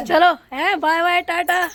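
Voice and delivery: high, loud cheery farewell call; called-out goodbye. Real, not acted. Aunt departing for the metro, calling out goodbye. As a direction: sing out bye-bye across a distance.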